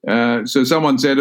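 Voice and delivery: ordinary talk, nothing marked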